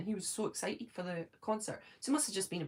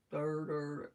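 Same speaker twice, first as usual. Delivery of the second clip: lower, droning robot sound; 5 semitones lower, 3 dB quieter than usual. Retold or imitated. Mocking the generic robot voice viewers understand, robot voice. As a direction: buzz it out like a lightly done robot. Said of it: "like, the robot voice?".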